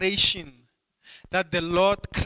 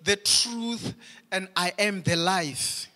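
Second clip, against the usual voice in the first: raised, driving pulpit delivery; fervent preaching; 3 semitones higher, 3 dB louder than usual. Acted. Pastor to congregation on exclusive truth: fervent preaching. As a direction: preach it out with mounting fire.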